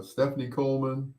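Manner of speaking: normal talking voice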